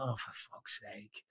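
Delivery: nearly whispered, soft and slow; barely voiced grumble; exasperated mutter. Real, not acted. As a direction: mutter it under your breath, fed up. Annoyed groan at himself.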